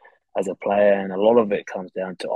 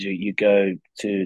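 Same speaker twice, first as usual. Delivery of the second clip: ordinary talk, nothing marked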